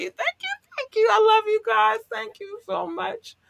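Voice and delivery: squeaky high thank-yous holding back tears; teary high-pitched gratitude; high, choked-up thanks. Real, not acted. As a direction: gush high to keep the tears away. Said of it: "hold back the tears".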